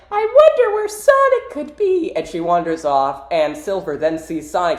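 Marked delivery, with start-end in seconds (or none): 0.1-2.1 put-on very high falsetto girl voice; exaggerated cartoon girl; Amy. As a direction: wonder aloud in a squeaky, loud falsetto. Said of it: "and Amy's like".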